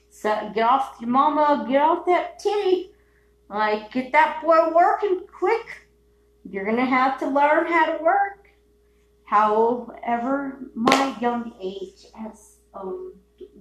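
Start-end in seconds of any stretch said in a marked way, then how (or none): 0.1-8.5 Southern parent. Retold or imitated. Mock Southern parent scolding.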